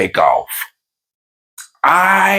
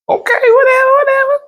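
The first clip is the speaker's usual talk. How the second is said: very high whiny wife voice; high whiny imitation of the wife; 3 dB louder, faster than usual. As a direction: whine it high and dismissive. Wife brushing it off.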